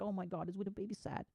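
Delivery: put-on parent cooing over a crying toddler. Parent comforting their sad child, high baby-talk coo. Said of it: "They're like".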